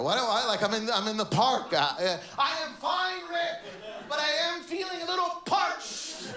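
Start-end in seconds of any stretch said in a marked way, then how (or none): 2.4-6.3 high, hammed-up stage voice; theater actor. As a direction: declaim it like park theater. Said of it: "Like I'm in theater".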